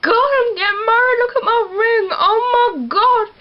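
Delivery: hammed-up tearful bride; mock crying Bella. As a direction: ham up a weepy bride showing off her ring. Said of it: "And Bella's like".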